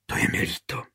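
soft whispered sympathy; tender whisper to comfort him. A narrator's reading. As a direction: breathe it out in a whisper. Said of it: "Tiše špitla".

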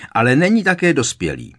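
high, loud agitated retort; getting upset. A narrator's reading. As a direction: flare up in agitation. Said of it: "rozčilovala se paní Weasleyová".

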